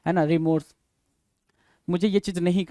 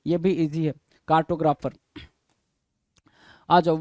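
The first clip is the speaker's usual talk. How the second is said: normal talking voice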